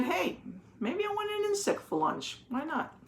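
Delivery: high, soft little bird thinking aloud; bird's voice. Acted. Teacher voicing the hungry bird for the kids, high and playful. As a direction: chirp it out like a curious bird.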